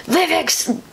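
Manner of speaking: high-pitched villain call; mock yell. Sledge calling his minions by the wrong name, mock yell. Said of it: "he completely yelled the wrong thing".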